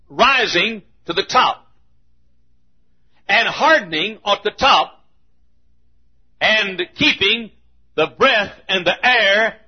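slow, measured sermon cadence; slow preaching. Acted. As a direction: draw each phrase out with long pauses.

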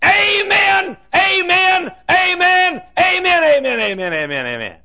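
high, loud hollers running down in pitch; hollered amens. Retold or imitated. Lone heckler answering the preacher from the balcony, high hollering. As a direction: holler high, then let the repeats tumble down fast. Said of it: "And he'd holler".

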